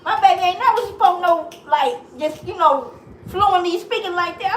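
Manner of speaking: retelling her amazement at her daughter's video, high and loud; shocked excitement. Retold or imitated.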